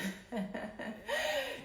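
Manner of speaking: a very high bubbling laugh; high giggle at her own joke. Real, not acted. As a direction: burst into giggles.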